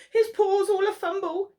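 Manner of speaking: ordinary delivery, nothing marked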